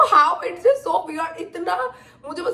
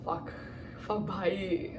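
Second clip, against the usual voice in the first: breathless, barely voiced swearing at the memory; choking up, almost no voice; near tears; 6 semitones lower, 14 dB quieter, much slower than usual. Real, not acted. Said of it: "सोच के रोना आ रहा है मुझे". (रोने से पहले दबी, लगभग बेआवाज़ गाली)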